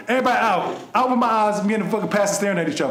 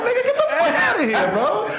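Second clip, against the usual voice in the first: very high, squealing indignant laugh-talk; laughing outrage; 4 dB louder than usual. Retold or imitated. Host retelling snapping at his brother, squealing with laughter. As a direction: shriek it through laughter, offended.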